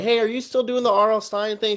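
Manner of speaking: high polite phone voice; I'm like. Retold or imitated. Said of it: "I'm like".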